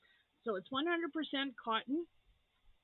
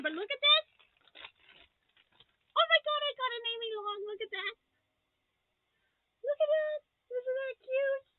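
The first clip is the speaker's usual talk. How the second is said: higher and louder, quick gush of delight; delighted squeal; 6 dB louder than usual. Real, not acted. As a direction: gush over the cute gift. Delighted by unicorn gift.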